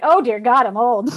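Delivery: loud dramatic exclamation; mock dismay. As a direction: exclaim in mock horror, loudly. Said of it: "I'm like".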